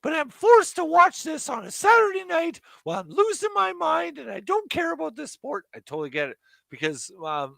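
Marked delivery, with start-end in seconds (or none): 0.0-5.7 higher, half-hearted British mum voice; a weaker go at the English mum; mild imitation of mom's British accent; mom's accent attempt. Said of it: "you can't do my mom's accent".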